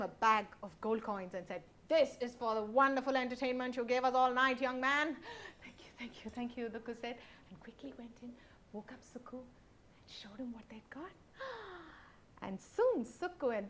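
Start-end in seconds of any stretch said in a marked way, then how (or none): 1.9-5.2 loud, hearty chief praise; grand chief. Acted. Chief rewards the singer. 5.4-11.1 soft, breathy whisper. Acted. Dukku sneaks back whispering. 11.4-11.9 high unvoiced gasp; amazed gasp. Sukku sees the gold.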